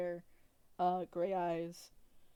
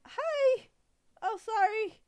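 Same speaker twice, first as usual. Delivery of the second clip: put-on very high, loud childlike squeal; high-pitched little girl apologizing brightly; little goblin girl; 10 dB louder, slower than usual. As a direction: pipe up as an excitable goblin child. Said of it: "a little goblin girl".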